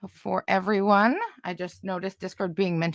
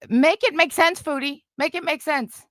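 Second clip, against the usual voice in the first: exasperated challenge; 7 semitones higher than usual. Real, not acted. Confronting streamer over contradictory friendship claims.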